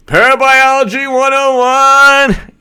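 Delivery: sustained high-pitched name call; stretched, high showy shout-out; drawn-out high call. Acted. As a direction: hold the name up high, slowly. Calls out viewer's name in performance.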